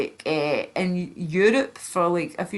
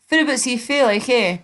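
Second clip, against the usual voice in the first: higher-pitched Aberdeen dialect impression; mock Aberdonian, louder and higher at the start; Aberdeen accent; 5 semitones higher, 4 dB louder than usual. Shown as a demonstration. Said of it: "Aberdeen speak like this".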